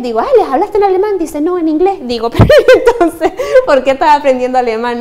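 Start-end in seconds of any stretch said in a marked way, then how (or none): 0.2-1.2 high, a bit louder mock question; teacher quizzing her student, hammed-up; imitating herself asking. 1.4-2.0 student answer. Retold or imitated. Student's reply quoted. 2.4-5.0 high, louder, laughing incredulous question; teacher asking why bother learning German, mock-surprised; mock disbelief.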